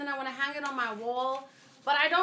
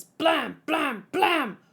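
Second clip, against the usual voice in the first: gunshot effects; 3 semitones higher, 3 dB louder, much slower than usual. Acted. Voices gunshot sound effects.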